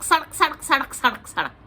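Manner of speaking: sadak, sadak chanted in sharp rhythm; crunching sadak. Acted. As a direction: snap out sadak like bones cracking. Neck-breaking sound effect.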